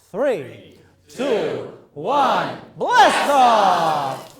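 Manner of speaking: countdown growing to a shout; building countdown. Real, not acted. Host warming up the audience, countdown building to a shout. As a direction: count steadily, then explode on the last words.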